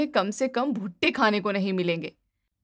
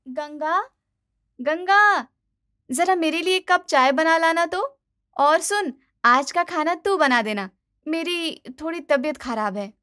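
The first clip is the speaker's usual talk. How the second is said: calling out to maid; 6 semitones higher than usual. Acted. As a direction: shout the name, then order tea.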